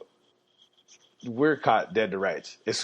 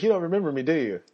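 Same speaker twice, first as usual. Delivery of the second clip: higher, teasing cop voice; friendly cop; 7 semitones higher, much faster than usual. Retold or imitated. Officer reminds the driver they've met, higher and teasing. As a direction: tease him, you know him.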